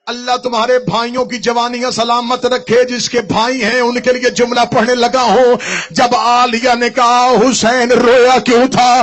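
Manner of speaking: loud, slow, high keening that swells to a sung cry; wailing majlis recitation. Acted. Reciter wailing Husain's cry for his brother to mourners. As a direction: lift the grief until it breaks into song.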